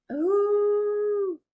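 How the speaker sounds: high, drawn-out gasped "ooh"; a long, high coo of delight. Real, not acted. Presenter reacting to viewers' bird-sound ideas, delighted high ooh.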